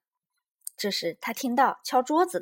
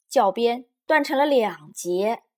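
plain reading voice

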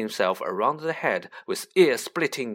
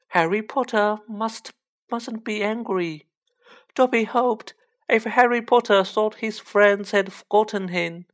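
high, pleading little elf; a fretful house-elf begging Harry; anxious house-elf voice; 9 semitones higher, 4 dB louder than usual. Acted.

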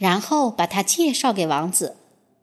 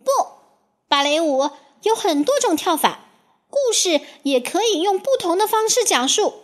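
retorting; 7 semitones higher than usual. A narrator's reading. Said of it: "克里斯汀反驳道".

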